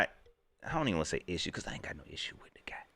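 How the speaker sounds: fast, hushed whisper; quick whispered disclaimer; whispered aside. Real, not acted. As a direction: drop to a whisper like a confession. Hushed aside about a coach.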